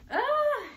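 high startled yelp; a short high yelp while changing; sharp yelp. Real, not acted. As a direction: let out a quick high cry. Sudden yelp mid-try-on.

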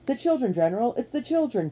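high-pitched urgent report; alarmed exclamation. A narrator's reading. Captain alerting Washington about the children, alarmed exclamation. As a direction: exclaim it in agitation. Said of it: "Captain McCrowley exclaimed".

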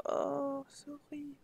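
very soft, stunned; hushed dismay. Real, not acted. Streamer hushed as her Sims pet dies, very soft. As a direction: breathe out the sad news.

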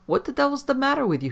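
high, loud, fast snap; snapped. A narrator's reading. Captain snapping at his trembling servant, high and fast. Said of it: "he snapped".

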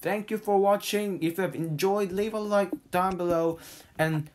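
bright, fast outro voice; youtuber outro. Acted. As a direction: wrap up the video cheerfully, quickly.

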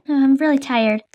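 ordinary delivery

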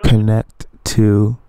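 dragged out word by word; very slow and emphatic. Real, not acted. Repeats for deaf scammer.

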